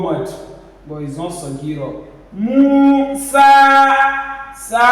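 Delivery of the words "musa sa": high chanted "Musa", both vowels held; stretched vowels. Shown as a demonstration. Teacher isolating alif sagira, "Musa" stretched. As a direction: draw out "Mu" and "sa". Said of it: "This is alif sagira".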